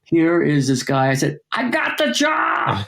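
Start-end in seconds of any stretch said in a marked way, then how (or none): 1.5-2.8 high-pitched, drawn-out cry of triumph; triumphant yell. Retold or imitated. Telling his waiting friend he got the job, triumphant. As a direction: stretch it out high, announcing big news.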